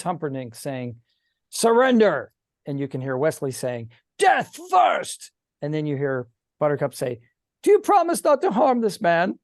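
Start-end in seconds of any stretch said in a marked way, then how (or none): 1.5-2.3 barked demand to give up; commanding shout. Acted. Prince ordering the hero to give up, shouting. 4.2-5.3 high, loud defiant cry; defiant shout. Acted. Hero refusing to surrender, high defiant shout. GM voices hero's defiant reply. 7.6-9.4 high, urgent plea; pleading princess. Acted. Princess begging the prince to spare the man, high plea.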